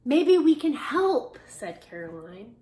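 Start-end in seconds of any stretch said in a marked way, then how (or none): 0.0-1.4 higher, much louder, slow offer; brightly volunteer to help; eager child. Acted.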